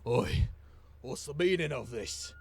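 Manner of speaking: a lightly voiced grumpy dwarf just woken; barking a little at the intruders, mildly gruff; a hint of a cranky old dwarf. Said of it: "he goes".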